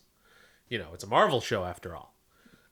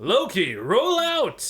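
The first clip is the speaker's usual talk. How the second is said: much higher, loud commanding "roll out"; Optimus Prime call; 11 semitones higher, 10 dB louder, slower than usual. Pretend. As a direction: boom it like a robot leader. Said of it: "what does Optimus Prime say?".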